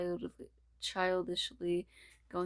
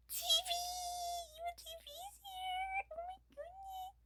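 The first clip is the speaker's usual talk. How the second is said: much higher squeal fading to a whisper; squealing excitement; 8 dB quieter than usual. Acted. Character squeals at TV in high excited voice. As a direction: squeal with breathless delight.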